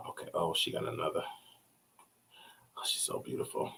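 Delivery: breathy whisper of awe; whispered admiration. Real, not acted. Streamer looking at her photos, whispering in awe.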